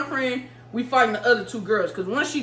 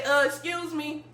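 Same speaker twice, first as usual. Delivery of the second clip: polite request; 7 semitones higher than usual. Retold or imitated. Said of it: "but I did say".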